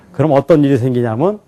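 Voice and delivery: usual speaking voice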